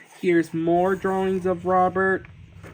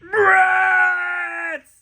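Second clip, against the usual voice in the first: long, high full-force yell; loud cartoon outburst, stretched out; Mister Crump yelling; 12 semitones higher, 7 dB louder than usual. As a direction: scream it out, holding the vowel. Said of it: "when Mister Crump yelling".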